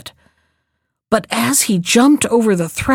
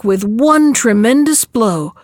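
ordinary delivery, nothing marked

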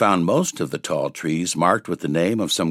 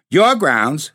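higher, louder scornful sneer; 9 semitones higher, 5 dB louder than usual. A narrator's reading. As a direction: spit it back with scorn. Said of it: "said Tom with a sneer".

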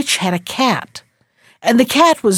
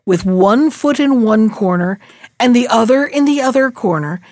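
ordinary delivery

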